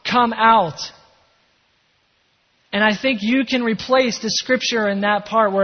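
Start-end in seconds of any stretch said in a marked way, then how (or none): 0.0-0.9 raised-voice imitation of a command; firm, restrained mock shout; retold shout. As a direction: call out as if to someone inside a tomb. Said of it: "he shouts".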